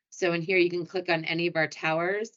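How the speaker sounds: ordinary talk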